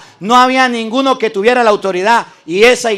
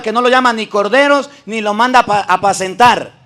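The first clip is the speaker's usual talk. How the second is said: ordinary talk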